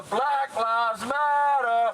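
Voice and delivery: slow, loud call to the crowd; drawn-out shout to a protest crowd; rally call. Real, not acted. Rally leader calling to crowd.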